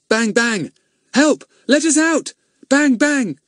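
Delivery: high, loud cries for help; shouting for help. Acted. Locked-in policemen shouting for help. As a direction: yell and bang to be let out.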